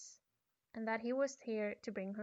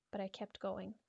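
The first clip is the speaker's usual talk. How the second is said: ordinary delivery, nothing marked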